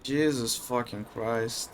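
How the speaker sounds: low, soft, drained anger; subdued fuming. Real, not acted. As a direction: seethe under your breath in a low voice. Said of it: "I am so mad right now".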